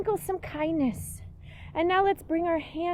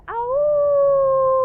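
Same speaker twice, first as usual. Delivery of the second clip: high, loud playful wolf howl; cheerful mock howl for the kids; 9 semitones higher, 9 dB louder than usual. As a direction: throw your head back and howl at the moon. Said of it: "howl at the moon".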